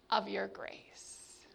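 breathy whisper trailing off. Acted. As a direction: let it fade to a whisper. Jonah's protest ends whispered.

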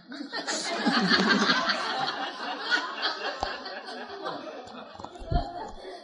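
airy high laugh; high breathy laughter. Real, not acted. Telling the church the story, high breathy laughter.

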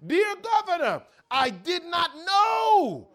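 high, slow, loud anguished plea; desperate cry. Acted. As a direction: pour out regret. Prisoner writes a desperate letter.

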